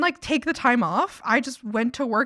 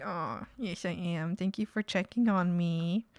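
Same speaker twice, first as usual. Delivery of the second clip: soft reply; 4 semitones lower, 8 dB quieter, slower than usual. Retold or imitated. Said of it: "and I was like".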